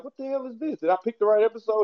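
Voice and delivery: high-pitched mock disbelief; retold bewilderment. Viewer recalls checking the episode, retold bewilderment. Retelling own confused reaction to episode.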